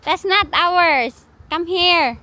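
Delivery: calling out. Real, not acted. Mother calling her daughter back on the walk, loud and high.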